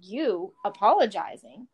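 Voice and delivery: incredulous, pitch leaping on each word; surprised. A narrator's reading. Mirabella to Faramir on his unexpected apology, surprised. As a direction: question it in round-eyed disbelief. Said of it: "her eyes round in surprise".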